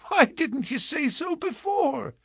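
put-on very high, piping little-girl delight; overjoyed small girl, voice pitched right up; gleeful child. A narrator's reading. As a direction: squeal it with sudden relief. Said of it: "laughing gleefully".

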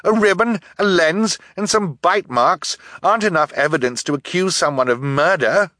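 high, forceful argument; indignant girl, pitched well up; protesting girl. A narrator's reading. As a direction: plead innocence point by point.

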